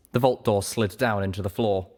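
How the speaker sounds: plain reading voice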